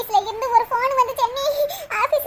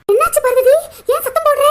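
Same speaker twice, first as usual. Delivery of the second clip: loud, anxious calling out; alarmed call; 7 dB louder than usual. Acted. Asking Parvathi why she is shouting, loud and anxious. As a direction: shout it out in worry.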